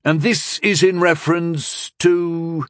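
high, slow, drawn-out query; playing dumb. A narrator's reading. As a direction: feign total ignorance, stretching the words. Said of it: "playing dumb".

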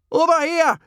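high, loud call; high-pitched shouted challenge; yelling. A narrator's reading. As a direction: yell to get their attention. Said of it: "yelled Hiccup".

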